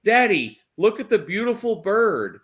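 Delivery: put-on high, bright-eyed child calling to his dad; little boy voice. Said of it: "my son says".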